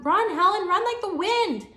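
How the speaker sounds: high, very loud pleading shout; urgent yell. Acted. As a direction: shout at grandma to flee. Child urging grandma to flee.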